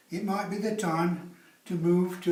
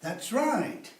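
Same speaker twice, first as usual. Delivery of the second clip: normal talking voice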